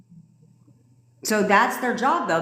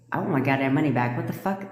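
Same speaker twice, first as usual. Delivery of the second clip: normal talking voice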